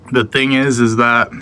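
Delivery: ordinary delivery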